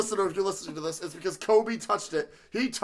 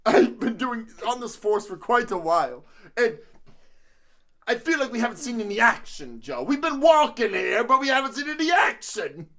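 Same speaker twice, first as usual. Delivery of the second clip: put-on higher, louder New Jersey accent; New Jersey cop; 4 semitones higher, 5 dB louder than usual. Bored cop complaining to Joe, hammed-up New Jersey accent. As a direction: gripe as a hammy Jersey cop. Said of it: "this is my New Jersey accent".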